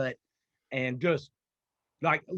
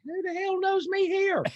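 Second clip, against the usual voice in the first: higher, slow retold astonished exclamation; startled surprise; 12 semitones higher, slower than usual. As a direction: act out being recognised abroad, stunned. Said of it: "and I'm like".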